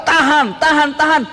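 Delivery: high, tense urging to hold on; high, pleading self-urging; straining to endure. Retold or imitated. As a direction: imitate someone enduring pain. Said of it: "Gemeteran Abu Bakar".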